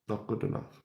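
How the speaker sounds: breathless whispered verdict. Shown as a demonstration. Vlogger whispering his verdict on the team. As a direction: drop to a bare disgusted whisper. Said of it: "repeated verdict, whispered".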